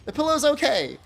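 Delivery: high, fast flustered no-no-no; nervous denial. Retold or imitated. Reassuring the shop lady while hiding his panic, fast flustered denial. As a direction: hurry to stop her worrying. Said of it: "I said".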